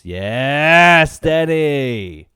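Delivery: loud, drawn-out approving shout; big loud yes; loud cheer. Real, not acted. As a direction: cheer the pick loudly. Cheering the pick.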